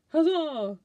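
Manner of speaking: loud, high heroic battle cry; hammed-up rallying shout, falling at the end; gallant huzzah. Prince of Swords to the rescue, heroic shout. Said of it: "But he's like".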